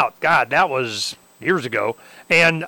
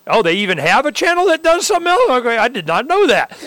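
high, fast mock surprise; surprised; 8 semitones higher, 4 dB louder than usual. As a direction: act startled at the news. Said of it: "I'm going".

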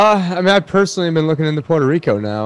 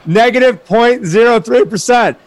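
much higher, excited proclamation; thrilled announcement; 9 semitones higher, 3 dB louder than usual. Real, not acted. Cohost reads out negative rate, highly excited. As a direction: punch out the shocking figure. Reading out shocking rate news.

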